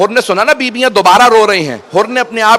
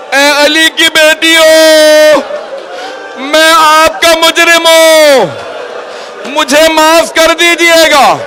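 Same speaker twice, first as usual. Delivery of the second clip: wailing plea; 6 semitones higher, slower than usual. Acted. Hurr begging the Prophet's granddaughters, slow wailing cry. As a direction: stretch each word in a rising cry.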